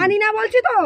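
high-pitched, exasperated outburst; angry refusal. Real, not acted. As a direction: insist angrily, voice shooting up. Refusal escalates in quarrel.

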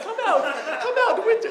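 high, sing-song fairy godmother voice, laughing; bad good witch impression. Munchkin-town good witch calling winter out, in a high sing-song voice. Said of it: "That's a horrible good witch impression".

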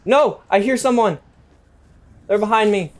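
loud, high, panicked yelling; panicked shout. Real, not acted. Gamer hearing an enemy behind, panicked shout. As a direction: yell in real alarm as someone creeps up.

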